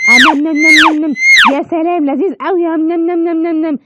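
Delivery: smacking yummy eating sounds; eating noises. Acted. Customer savoring eggs with loud nom nom sounds. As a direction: nom nom like a happy eater.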